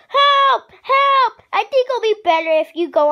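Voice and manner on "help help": calling for help. Acted. Frozen friend calling out.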